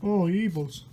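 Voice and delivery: usual speaking voice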